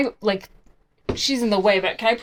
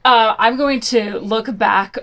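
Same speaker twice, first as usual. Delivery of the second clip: ordinary talk, nothing marked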